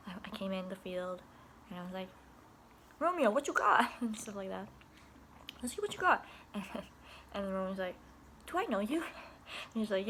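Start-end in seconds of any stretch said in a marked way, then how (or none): 3.0-3.9 higher, louder teasing call; playful challenge. Retold or imitated. 5.6-6.2 much higher teasing dare; playful dare. Retold or imitated. Teasing challenge to a kid. 8.5-9.0 higher, lightly voiced puzzled boy; puzzled kid. Romeo doesn't recognize her.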